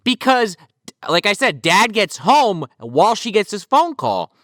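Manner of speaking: ordinary talk, nothing marked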